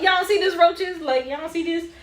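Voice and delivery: loud, high-pitched disbelief; incredulous outcry. Retold or imitated. Freaked-out cousin asking the others about roaches, incredulous. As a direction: cry out as if nobody else notices.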